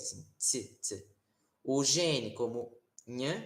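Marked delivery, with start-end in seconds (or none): 0.4-1.1 Tsi, tsi with a crisp ts; ts sound. Shown as a demonstration. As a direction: show the ts of Latin t. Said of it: "como se fosse um T S".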